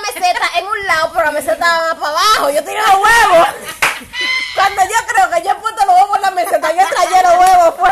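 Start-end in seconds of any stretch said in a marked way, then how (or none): 3.5-4.5 very high real squeal of laughter; cracking up mid-story. Real, not acted.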